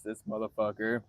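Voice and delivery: usual speaking voice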